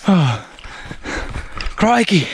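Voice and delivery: high slow mock Aussie scare; startling boo. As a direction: sneak up and spook her, Crocodile Hunter style. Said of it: "you ask if you scared me".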